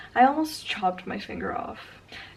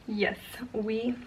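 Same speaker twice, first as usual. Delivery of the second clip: hushed giggly secret; conspiratorial laughing whisper; giggling whisper; 8 dB quieter than usual. Real, not acted. Giggly aside about removed logo.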